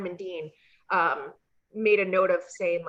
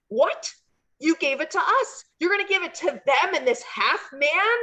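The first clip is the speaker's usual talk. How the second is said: higher mock indignant protest; mock outrage; 7 semitones higher than usual. Punk to the clerk, mock outraged protest. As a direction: act offended at the clerk. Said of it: "Punk's like".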